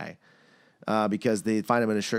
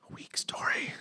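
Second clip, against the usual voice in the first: hushed, dismissive whisper; whispered aside; 9 dB quieter, slower than usual. Real, not acted. Judging the movie's plot, whispered aside. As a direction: whisper it under the talk.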